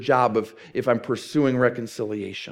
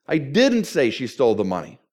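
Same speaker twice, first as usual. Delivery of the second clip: "didn't" stressed as a firm denial. Shown as a demonstration. Preacher showing how emphasis changes meaning, stress on didn't. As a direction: punch the word "didn't". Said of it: "if I change the emphasis".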